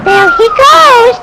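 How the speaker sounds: loud, slow call; shout. Acted. Watching someone run out.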